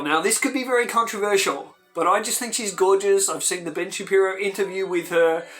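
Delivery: ordinary talk, nothing marked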